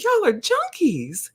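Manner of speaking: high, slow, drawn-out teasing; teasing high call-out. Retold or imitated. To the laughing nurse, a high slow teasing call-out. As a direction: tease her high and slow, pitched well up. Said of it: "she just started rolling and just cracking".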